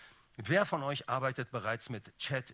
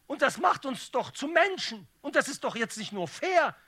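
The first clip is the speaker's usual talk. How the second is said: loud, high comic tirade building to a shout; mock-indignant outburst; 9 semitones higher, 8 dB louder than usual. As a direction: rail at the injustice, climaxing on the last line. Mock-indignant speech building to pun.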